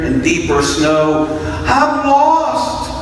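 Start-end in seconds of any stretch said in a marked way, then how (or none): 1.7-2.9 sobbing. A narrator's reading. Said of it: "sobbed the baby field mouse".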